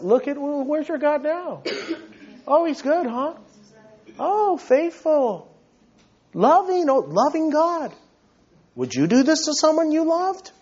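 high-pitched sarcastic needling; taunting Satan. Retold or imitated. Satan goading suffering Job, taunting. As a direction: sneer it as mock questions about God. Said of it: "Satan always says that".

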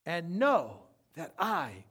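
slow, settled reassurance; calm. Acted. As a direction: bring it down gently. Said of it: "quiet after shout".

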